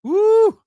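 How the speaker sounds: high, loud "woo" cheer; sharp hollered whoop of approval; excited whoop. Real, not acted. Cheering on a praised athlete, excited whoop.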